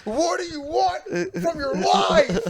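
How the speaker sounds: high, laughing melodrama line; mock movie dramatics. Friend parodies a weepy movie scene, high and laughing. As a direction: ham it up like a tearful movie scene.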